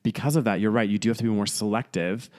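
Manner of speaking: usual speaking voice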